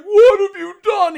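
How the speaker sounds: high, loud, slowed cry; mock anguish. Retelling Ben over Hayden's body, in a loud hammed-up cry. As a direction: ham up the horror. Imitating Ben's horror.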